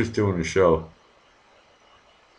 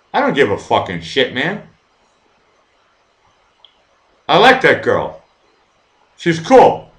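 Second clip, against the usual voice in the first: loud, high, heated yelling; furious shout; 8 semitones higher, 9 dB louder than usual. Real, not acted. Streamer defending Elena to viewers, furious yelling. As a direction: shout them down, defending a friend.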